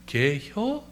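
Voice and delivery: tiny question swooping upward; Chungcheong dialect. Shown as a demonstration. Long question cut short for laughs, Chungcheong accent. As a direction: lift it sharply like a Chungcheong local. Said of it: "충청도 말로는요".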